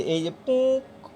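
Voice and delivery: a slow, soft little puk sound; mock pop sound. Shown as a demonstration. Teacher demonstrates the sound effect he wants to add. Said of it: "এরকম একটা শব্দ".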